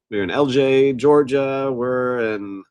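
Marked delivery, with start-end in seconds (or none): none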